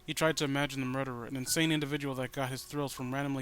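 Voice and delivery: calm, even read-aloud narration; story narration. A narrator's reading. Reading the story to viewers in a steady narrator voice.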